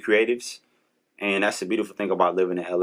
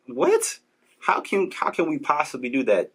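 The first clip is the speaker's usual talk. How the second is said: incredulous; 6 semitones higher than usual. Real, not acted. Incredulous rhetorical question.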